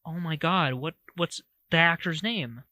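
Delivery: normal talking voice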